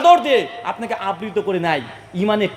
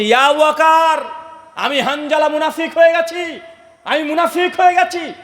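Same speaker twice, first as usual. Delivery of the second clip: anguished crying out; 6 semitones higher, 3 dB louder than usual. Acted. Hanzala confessing to Abu Bakr that he is a hypocrite, crying out. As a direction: wail the confession at full preaching pitch.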